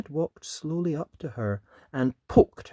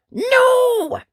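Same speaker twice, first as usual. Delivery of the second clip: much higher, loud defiant shout; Ned defying his mum for the first time; cross no; 12 dB louder than usual. Acted. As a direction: fling it out, hot and cross. Ned refusing tea.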